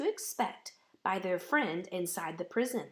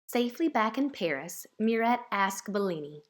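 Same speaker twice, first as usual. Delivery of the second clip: ordinary delivery, nothing marked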